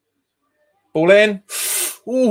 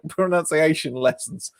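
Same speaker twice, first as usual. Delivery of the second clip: normal talking voice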